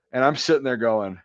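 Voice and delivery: ordinary talk, nothing marked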